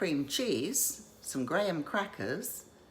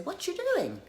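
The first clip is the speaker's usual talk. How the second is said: much higher, chirpy friend calling to check in; friend's voice. Retold or imitated. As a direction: play the nosy friend on the phone, pitched way up. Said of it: "my friends will call me … and say".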